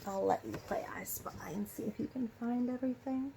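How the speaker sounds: soft unvoiced whisper, a bit quick. Real, not acted. Teacher inviting the children to play I Spy, whispered. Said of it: "teacher whispers to class".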